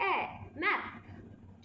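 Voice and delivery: short, quick "ए" as in "मैप", said softly; short A sound. Shown as a demonstration. Teacher showing learners the short sound of A, short vowel. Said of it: "जल्दी से बोला गया".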